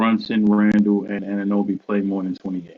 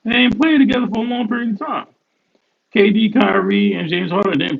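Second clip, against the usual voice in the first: much higher and fast, pressing the point; high, rapid, worked-up argument; excited insistence; 9 semitones higher than usual. Real, not acted. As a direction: jump way up in pitch to hammer it home.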